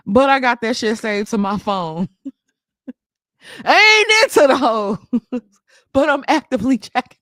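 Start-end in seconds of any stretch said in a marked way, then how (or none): 3.6-7.2 laughing through the words, louder; laughing mock quote. Streamer laughing while mocking a man's excuse. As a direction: giggle out the quote you are mocking.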